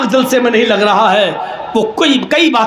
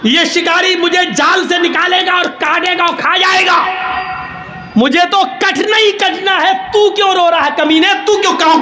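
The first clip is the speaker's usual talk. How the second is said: angry pigeon shout; 8 semitones higher than usual. Acted. Pigeon shouting at the crow in the hunter's net. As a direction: snap at him like a doomed bird.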